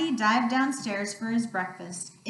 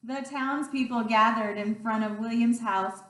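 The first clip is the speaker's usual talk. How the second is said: plain reading voice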